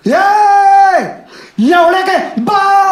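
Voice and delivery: very high, loud, drawn-out holler; yelled abuse. Retold or imitated. Scooter rider abusing a taxi passenger, stretched yell. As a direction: scream the insult while speeding past. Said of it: "और क्या गाली दिया, इधर से आया".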